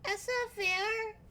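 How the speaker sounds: put-on a lost kid looking around; small confused child calling out; Rad the kid. GM voices Rad searching.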